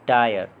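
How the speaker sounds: टायर with an "eye" glide. Shown as a demonstration. Teacher showing Y said as आय, gliding vowel in टायर. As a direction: say टायर as "taa-yar". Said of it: "वाई का उच्चारण आय की तरह".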